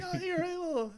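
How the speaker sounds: very high mock crying whine; fake-cry it like Paulie; mock whining Paulie. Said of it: "starts doing his bit of".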